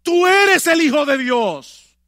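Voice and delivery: loud, high, slow proclamation; demons crying out. Retold or imitated. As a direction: cry it out like a possessed man. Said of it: "daban voces diciendo".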